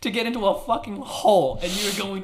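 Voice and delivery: high, loud, laughing disbelief; incredulous outburst. Real, not acted. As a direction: explode in exasperated disbelief. Mock outrage at player's answer.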